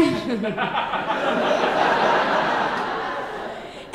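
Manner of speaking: silent, airy laugh; breathless laugh. Real, not acted. After hanging up on her sister, airy silent laugh. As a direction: laugh without voice.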